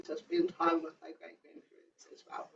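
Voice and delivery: soft whisper. Real, not acted. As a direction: let it drop to a whisper. Whispered aside.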